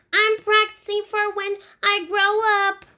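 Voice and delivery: put-on a small child explaining matter-of-factly. Fudge in the tree explaining to Sheila, in a high little boy voice.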